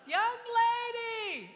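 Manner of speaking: long, drawn-out high holler; hailing shout. Retold or imitated. Yacht owner hailing a stranded woman, drawn-out shout. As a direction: call slowly across open water.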